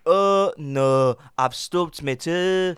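mock Scouse moan, slow; a Scouse whinge about a stubbed toe; Scouse accent. Host acting a Scouse bit about his toe, mock accent. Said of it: "Speaking Scouse".

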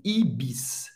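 "Ibis" as ee-BEESS, ending in a clean S; final S pronounced. Shown as a demonstration. Teacher showing learners the last letter, final S pronounced. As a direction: say "Ibis" with S, not SH, at the end. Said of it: "vai ter um som do S mesmo".

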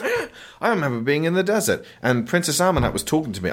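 imitating a hero struck by memories; dazed flashback. Action hero having flashbacks of the desert, mock-dramatic. Mocking Tom Cruise's flashback.

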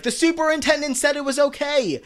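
squeaky mock Becky quote; Becky whining that she has permission; imitating Becky. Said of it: "Becky's like".

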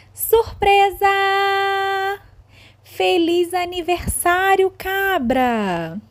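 high, loud party cheer; surprise party shout. Acted. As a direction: shout surprise at the birthday goat.

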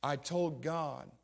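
slow, deliberate, each word weighed; slow firm testimony. Retold or imitated. Father answering the preacher, slow and firm. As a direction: lay it down slowly as settled truth.

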